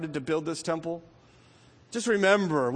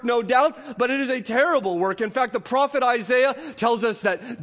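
high, loud sermon cadence; full-force preaching; 6 semitones higher, 4 dB louder than usual. Acted. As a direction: preach it like a revival.

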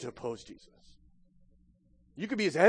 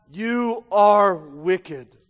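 each word drawn out very slowly and loud; slow solemn verdict; 9 dB louder, much slower than usual. Acted. Preacher delivers Jesus' verdict on the lazy servant, very slow and loud. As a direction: let the verdict fall word by word.

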